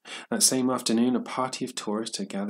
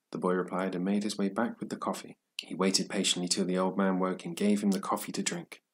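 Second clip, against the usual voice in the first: plain reading voice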